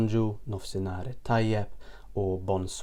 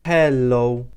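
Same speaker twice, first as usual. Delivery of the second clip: HELLO drawn out very slowly, syllable by syllable; slow demonstration; 8 dB louder than usual. Shown as a demonstration. Teacher repeats the greeting for learners, slowly stretched hello. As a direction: stretch hel-lo out for learners, loud and clear. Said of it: "That's hello".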